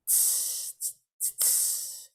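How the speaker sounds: voiceless tss cymbal beats; hissed cymbal sounds. Shown as a demonstration. Goddess demonstrating the cymbal beat, hissed. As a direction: hiss out a hi-hat rhythm. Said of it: "Syncopate with me".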